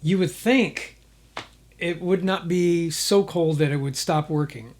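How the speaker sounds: normal talking voice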